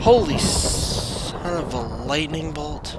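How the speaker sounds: loud, slow startled outburst; startled exclamation. Real, not acted. Gamer startled by a lightning strike, loud slow exclamation. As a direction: blurt it out in shock, drawn out.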